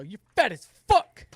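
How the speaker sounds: loud, slow self-scolding shout; mock yell at self. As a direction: bark it at your own reflection. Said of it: "and I go".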